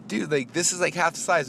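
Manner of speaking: high-pitched retold disbelief; incredulous protest. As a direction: object as if it's absurd. Retold protest at wrong mower.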